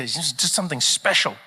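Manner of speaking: ordinary talk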